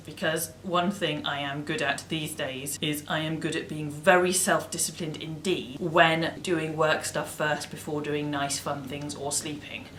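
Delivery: ordinary talk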